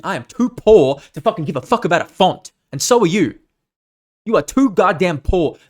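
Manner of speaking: loud, high mock rant at himself. Scolding himself after four hours on fonts, loud mock rant. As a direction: shout it like scolding yourself over fonts. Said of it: "being like".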